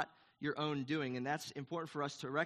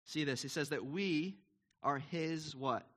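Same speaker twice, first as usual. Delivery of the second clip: ordinary talk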